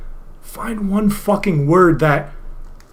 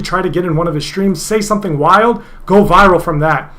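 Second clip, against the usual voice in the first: usual speaking voice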